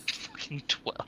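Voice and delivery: under the breath, slow; muttered curse. Real, not acted. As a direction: grumble it quietly. Bad roll result muttered.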